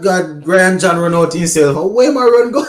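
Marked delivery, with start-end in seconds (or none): 1.8-2.7 high, laughing exclamation breaking into laughter. Real, not acted. Laughing at the batsman's run-out.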